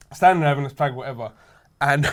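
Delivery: normal talking voice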